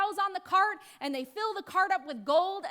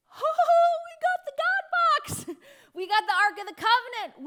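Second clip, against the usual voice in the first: loud, high, triumphant crowing with a laugh; gloating Philistines; 9 semitones higher, 9 dB louder than usual. Retold or imitated. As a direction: crow over the captured prize, loud and high. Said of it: "The Philistines are like".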